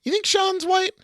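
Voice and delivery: high, squeaky incredulous question; mock disbelief. Real, not acted. Cohost asking in high mock shock about the writer.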